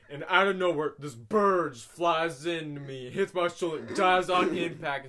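loud, slow, hyped storytelling friend; excited retelling. Retold or imitated. Friend retelling a bird story, loud and hyped. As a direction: ham up a friend's breathless story.

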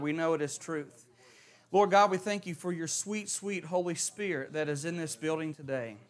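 soft, reverent prayer falling away quietly. Acted. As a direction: pray humbly over the congregation. Leads a prayer.

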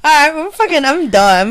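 giddy. Real, not acted. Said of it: "I'm fucking high as hell".

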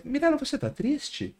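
a lightly voiced, high, slow question to Mirella; flat caring question. Speaker shows his scripted question, lightly high. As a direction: ask Mirella plainly, by rote. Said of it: "Aí eu falo assim".

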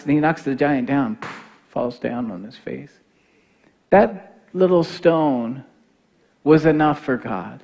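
usual speaking voice

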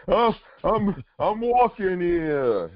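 very high, drawn-out Bronx Italian holler; Bronx Italian accent. Acted. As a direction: stretch it out, slow Italian-American wise guy. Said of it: "he's like the Bronx of olive oil".

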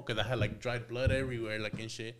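ordinary delivery

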